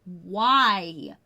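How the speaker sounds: drawn-out, exasperated WHY. Retold or imitated. Camper refusing the silly prayer, exasperated. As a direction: stretch out one pleading why.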